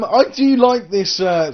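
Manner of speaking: ordinary talk, nothing marked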